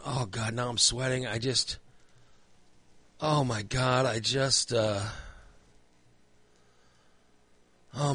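soft, halting inner monologue; retold quiet dread. Man sweating in bed, hushed mock-doomed self-talk. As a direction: lament quietly to yourself, as if doomed. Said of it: "I start feeling claustrophobic".

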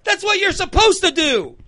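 high-pitched, indignant comic scolding; Chris Rock. Retold or imitated. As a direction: hammer it home high and exasperated. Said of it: "And Chris Rock says".

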